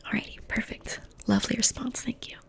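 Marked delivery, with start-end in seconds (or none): none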